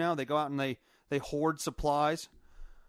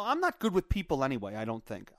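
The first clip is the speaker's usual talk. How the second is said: usual speaking voice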